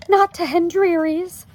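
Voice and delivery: high, loud protest from Homley; crying out. A narrator's reading. Wife refuses to move to relatives, crying out. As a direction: cry out against the idea. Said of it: "cried Homley".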